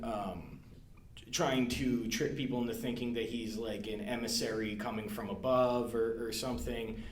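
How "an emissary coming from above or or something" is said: ordinary talk, nothing marked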